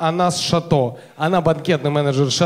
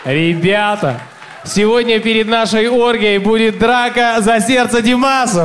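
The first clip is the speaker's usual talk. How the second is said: announcer; 3 semitones higher, 4 dB louder, slower than usual. Acted. Comic announces a fight.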